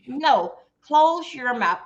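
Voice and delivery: "close your mouth" slowly spelled out; slow, deliberate order. Shown as a demonstration. Coach telling the ladies to hush, slow and firm. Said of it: "repeated advice".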